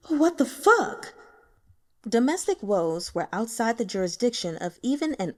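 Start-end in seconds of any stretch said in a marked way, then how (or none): 0.1-1.1 slow, higher and louder outraged cry; exasperated outburst. Acted. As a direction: spit it out in disbelief. Guo angry at the family.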